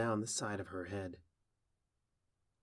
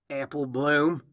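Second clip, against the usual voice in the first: loud, raised call of a name; yelling; 4 semitones higher, 10 dB louder than usual. Acted. Applejack calling to the filly on her head, yelling. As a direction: call out far too loudly for someone close. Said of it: "you don't have to yell".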